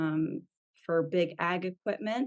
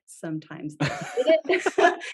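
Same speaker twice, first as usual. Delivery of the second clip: slow, laughing through the words; 7 semitones higher, 5 dB louder than usual. Real, not acted. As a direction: chuckle it out. Joking about clients hating posts.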